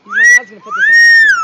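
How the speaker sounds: very high, loud fast squeal; excited shout. Real, not acted. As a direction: blurt it out in a squeal.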